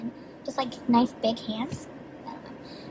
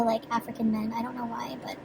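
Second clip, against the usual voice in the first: shy whisper; 3 semitones lower, 7 dB quieter than usual. Real, not acted. Shy admission of attraction.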